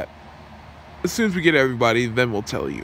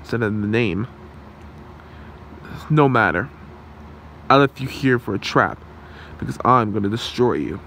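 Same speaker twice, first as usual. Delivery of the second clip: normal talking voice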